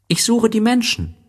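put-on high childlike story voice; voiced little prince, lifted well up. A narrator's reading. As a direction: pitch it high for a small boy.

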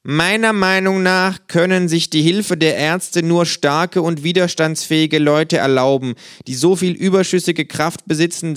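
grand, declaimed literary quote; reciting a quotation. Acted. Kangaroo quoting Molière to justify itself, grand recitation. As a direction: declaim it with mock solemnity. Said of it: "Ich zitiere".

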